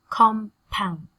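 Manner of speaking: "compound" split into two separate beats, COM, POUND; carefully separated syllables in "compound". Shown as a demonstration. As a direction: pause between "com" and "pound". Teacher breaks word into syllables.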